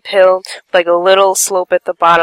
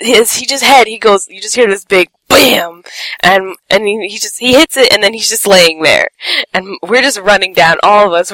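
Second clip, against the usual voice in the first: ordinary delivery, nothing marked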